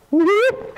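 a squeaky high whoop; high playful whoop mid-jump. Acted. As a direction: yelp it high as you hop.

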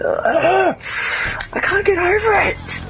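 can't contain the joy; high-pitched thrilled outburst; overjoyed. Real, not acted. Overjoyed at reaching 71 subscribers.

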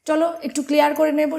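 ordinary talk, nothing marked

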